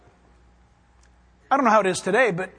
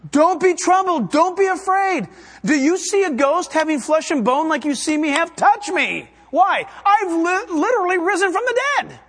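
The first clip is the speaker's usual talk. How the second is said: higher, louder reassurance; earnest Jesus, pitched up and loud; urgent Jesus; 6 semitones higher, 3 dB louder than usual. Retold or imitated. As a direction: press them to believe, touch me. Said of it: "He's there and He's saying".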